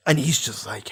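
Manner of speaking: ordinary talk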